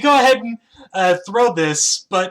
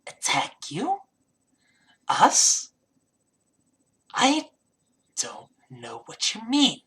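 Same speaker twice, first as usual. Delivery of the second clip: kobold voice; 4 semitones higher, slower than usual. Acted. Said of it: "in almost perfect common goes".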